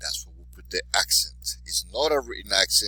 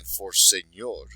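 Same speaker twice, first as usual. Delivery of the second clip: ordinary talk, nothing marked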